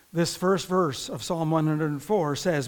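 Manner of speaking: ordinary talk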